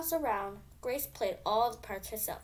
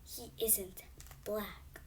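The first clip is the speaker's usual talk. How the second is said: soft hushed voice, much quieter; whispered; 9 dB quieter, much slower than usual. A narrator's reading. Girl whispering to Grace at school, soft and hushed. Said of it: "whispered Natalie".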